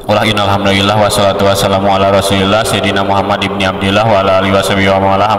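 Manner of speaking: rattled-off sermon opening; rushed, no spaces. Shown as a demonstration. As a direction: race through it in one unbroken run. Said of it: "ulah ayeuna beberebetan teuing".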